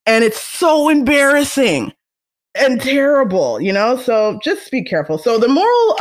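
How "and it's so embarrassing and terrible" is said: higher and slow, stretching so; slow, hammed-up dismay; dramatic dismay. Real, not acted. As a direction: draw out the cringe. Exaggerated dismay.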